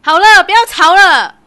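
loud, slow pleading shout to stop them; slow, loud cry to break up the quarrel; stop fighting. Acted. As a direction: girlfriend yelling at the two to stop fighting. Stopping an argument.

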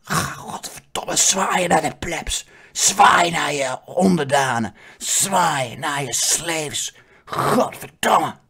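slow hissed commands under the breath; whispered hissed orders. Retold or imitated. Sisters whispering on the balcony, slow hissed orders. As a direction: hiss the orders through the smile. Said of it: "dat fluisteren ze".

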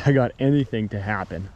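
usual speaking voice

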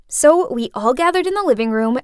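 ordinary delivery, nothing marked

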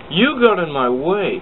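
high, loud accusing cry; angry cry. Acted. James arguing with Thomas again, crying out high and loud. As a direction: point the finger. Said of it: "cried James".

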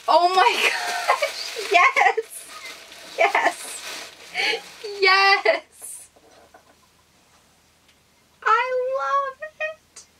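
high, slow, laughing delight; thrilled squeal. Real, not acted. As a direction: squeal over a loved gift. Thrilled at mug.

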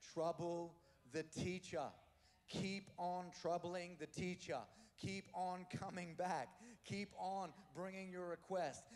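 slow, deliberate preaching; preacher urging the online viewers, slowly and firmly; slow emphatic exhortation. Real, not acted. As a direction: press each word, slowly building.

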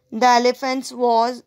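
plain reading voice

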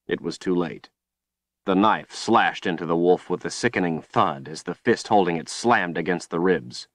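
ordinary delivery, nothing marked

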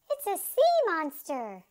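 high, loud cry of alarm; shrieking. A narrator's reading. Lady warns of a monster in the water, shrieking. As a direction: shriek a warning, punched and falling at the end. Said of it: "she shrieked".

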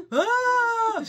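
sudden high laughing cry; laughing shriek. Real, not acted. Shrieking laugh at the dog chewing the carpet. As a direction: yelp out mid-laugh at the dog.

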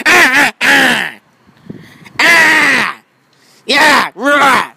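high loud play scream and roar; scare shouts. Acted. Toy jumps out to scare, loud playful yells. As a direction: jump out and yell to scare.

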